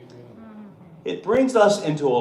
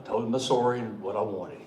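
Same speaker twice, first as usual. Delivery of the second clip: normal talking voice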